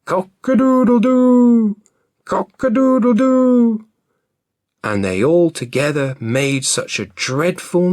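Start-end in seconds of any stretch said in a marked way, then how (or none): 0.0-3.9 loud, very high crowing; full-throated loud cock crow; rooster crow. A narrator's reading. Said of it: "and the cock crowed".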